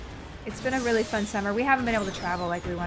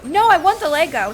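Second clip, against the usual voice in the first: put-on child insisting; 6 semitones higher, 8 dB louder than usual. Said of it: "child answers mom".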